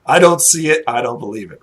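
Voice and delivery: emphatic, mildly scoffing farmer; firm refusal to buy it; dismissive disbelief. Acted. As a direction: wave it off as nonsense you won't believe. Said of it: "but he just doesn't believe".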